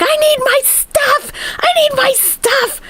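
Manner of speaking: very high, drawn-out imitation wail; hammed-up squealed demand, far above own voice; mock hysterical demand. Streamer calls police over her stuff, mock hysterical wail.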